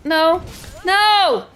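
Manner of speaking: loud, high drawn-out no; dismayed shout. Real, not acted. Gamer losing the fight, loud dismayed cry. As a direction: wail it at the screen. Gaming frustration outburst.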